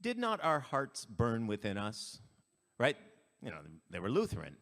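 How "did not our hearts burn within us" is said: understated slow quote. Retold or imitated. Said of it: "they go".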